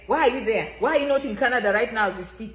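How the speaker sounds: usual speaking voice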